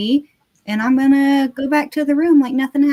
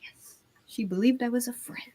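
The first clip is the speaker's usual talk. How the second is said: hushed, whispered triumph; gleeful whisper; 10 dB quieter than usual. Real, not acted. Player relieved the bluff worked, whispering. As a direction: whisper it softly to yourself.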